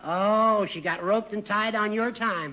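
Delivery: usual speaking voice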